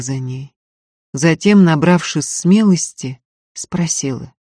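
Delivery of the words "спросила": usual speaking voice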